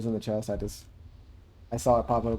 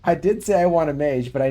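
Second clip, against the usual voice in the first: louder and higher, pressing his point; loud defensive protest; 4 semitones higher, 9 dB louder than usual. Real, not acted. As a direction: stand your ground, raising the voice. Defending himself in a group argument.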